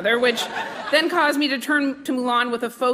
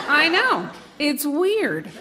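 usual speaking voice

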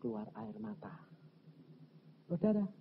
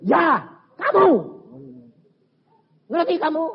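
acted accusation; 13 semitones higher, 8 dB louder than usual. Pastor confirms to the husband he is guilty, accusing. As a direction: drive the blame home loudly.

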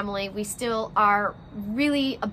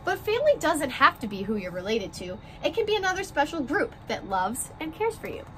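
gentle picture-book read-aloud, rhymes bouncing along; reading to children; 3 semitones higher than usual. A narrator's reading. Teacher reading a class picture book to her first graders, story-time voice.

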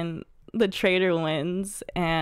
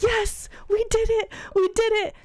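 mock celebration, pitched way up; retold triumphant cheer. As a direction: imitate a high, jubilant victory cry.

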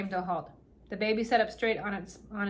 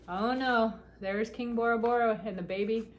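ordinary delivery

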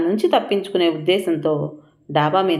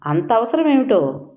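high sarcastic jab; 6 semitones higher than usual. A narrator's reading. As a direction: ask it mockingly, pitched up. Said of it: "Shyamala వెటకారంగా అడిగింది".

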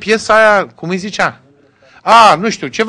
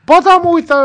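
eager reply; 11 semitones higher, 6 dB louder than usual. Retold or imitated. Eager reply to American Romanians.

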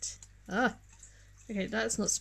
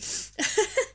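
breathy laugh; 11 semitones higher than usual. Real, not acted. Embarrassed laugh after sneezing.

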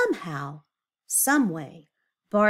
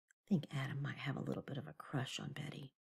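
teasing aside; 5 semitones lower, 16 dB quieter, faster than usual. Real, not acted.

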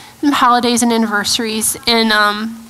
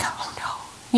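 hushed aside between worried parents; dismayed whisper; 13 dB quieter than usual. Retold or imitated. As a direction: whisper it under the breath, imitating private dismay. Parents' private dismay, retold.

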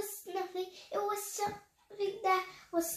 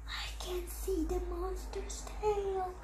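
hushed, voiceless whisper; 7 dB quieter than usual. Acted. Gran spotting the monster's tail, secretive whisper. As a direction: whisper it while creeping up.

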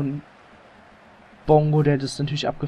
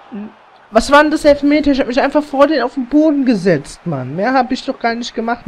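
indignant protest; 9 semitones higher, 5 dB louder than usual. Real, not acted. Protesting a penalty call.

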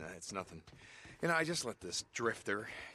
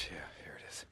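muttered whisper; 3 semitones lower, 11 dB quieter than usual. Acted. Muttering alone in bunker.

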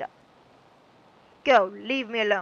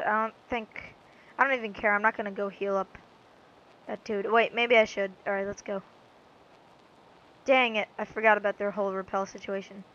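ordinary delivery

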